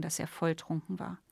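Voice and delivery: usual speaking voice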